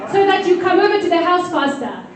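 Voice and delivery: ordinary delivery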